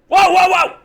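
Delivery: high, loud alarm; startled outburst. Real, not acted. Startled by the slideshow, high loud exclamation. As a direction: cry out to halt everything.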